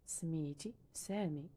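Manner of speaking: سميتي سامي said slowly, each word drawn out; slow repeat. Shown as a demonstration. Teacher repeating the Darija line slowly for learners. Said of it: "teacher repeats phrase slowly".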